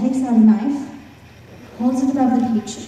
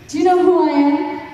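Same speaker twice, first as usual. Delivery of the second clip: raised, indignant hero's retort; proud challenge; 5 semitones higher than usual. A narrator's reading. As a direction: throw it back at the dog, offended. Momotaro challenges the dog.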